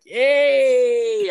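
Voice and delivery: high, long, loud hail; loud drawn-out greeting. Retold or imitated. Customer greets the woman at the window, loud and drawn out. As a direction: call it out high and stretch it.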